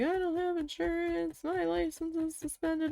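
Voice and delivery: high, frantic imitation of a panicking driver; panicky woman. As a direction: play the frightened driver, pitch way up. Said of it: "She was hysterical".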